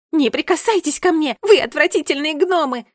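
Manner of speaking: high, loud disgusted fury; very loud scream. A narrator's reading. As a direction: scream at them to back off. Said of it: "закричала Джеральдина так громко". (громкий яростный крик)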